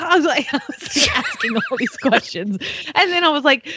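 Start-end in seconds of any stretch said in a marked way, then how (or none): none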